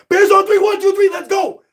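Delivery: loud, high shouted count; rally the team on three; team break shout. Real, not acted.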